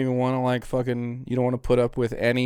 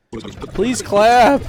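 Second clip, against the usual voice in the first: please clap, pitched up and louder; higher, louder mock plea for applause; 8 semitones higher, 8 dB louder than usual. Shown as a demonstration. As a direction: beg the room for applause, hammed up. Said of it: "That's the second time he's tried".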